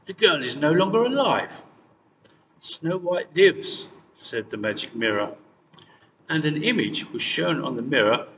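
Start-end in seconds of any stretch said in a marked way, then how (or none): 0.1-1.6 loud, high furious denial; the queen screams it at the mirror; angry scream. A narrator's reading. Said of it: "screamed the queen". 2.6-3.9 slow, eerie otherworldly voice; magic mirror. A narrator's reading.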